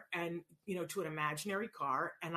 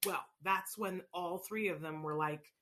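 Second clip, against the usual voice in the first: ordinary delivery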